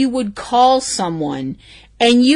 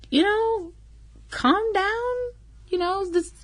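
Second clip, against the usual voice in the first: higher and softer, weary calm-down; exasperated soft plea; 9 semitones higher, 8 dB quieter, slower than usual. Real, not acted. Vlogger telling offended people to relax, exasperated and soft.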